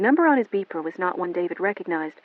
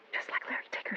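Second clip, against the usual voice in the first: much softer whisper; stunned whisper; 14 dB quieter, faster than usual. A narrator's reading. Melanie whispering her thought in shock. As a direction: breathe it in shock.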